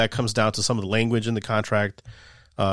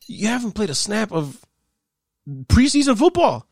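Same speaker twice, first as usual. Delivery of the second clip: exasperated outburst; 9 semitones higher than usual. Real, not acted. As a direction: stretch it out in outrage. Incredulous rant continues.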